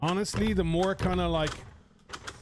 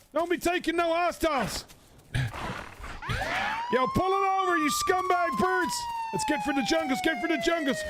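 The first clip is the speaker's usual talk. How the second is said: yelling orders mid-fight, pitched way up; frantic shouting; 13 semitones higher than usual. Pretend. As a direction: bellow at attackers in a panic. Shouting during gang attack.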